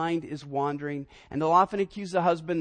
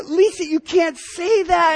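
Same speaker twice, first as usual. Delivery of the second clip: higher, louder, slow shocked protest; 10 semitones higher, 9 dB louder, slower than usual. Retold or imitated. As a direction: gasp it out in mock horror. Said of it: "and I go".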